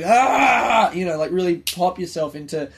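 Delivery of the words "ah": loud high mock lion roar. Describing a morning routine he skips, loud high mock lion roar. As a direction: roar yourself awake like a lion. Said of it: "do that lion shit".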